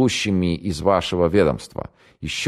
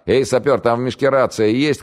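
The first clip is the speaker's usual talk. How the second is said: higher, louder call into the dark; 9 semitones higher, 4 dB louder than usual. A narrator's reading. The major to the sapper in the dark, calling out loud. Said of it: "крикнул Октябрьский".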